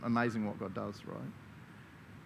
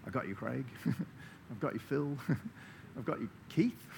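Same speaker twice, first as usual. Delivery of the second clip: tender; 6 dB quieter than usual. Pretend. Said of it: "God goes".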